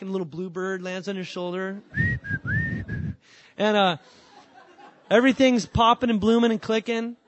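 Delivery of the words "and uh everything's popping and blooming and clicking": louder, laughing as the joy bubbles up. Real, not acted. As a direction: laugh through the good times. Joyful springtime in faith.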